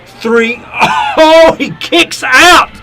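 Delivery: high, loud, shouting the near-fall; excited wrestling commentator. Acted. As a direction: yell the big move live. Live match play-by-play.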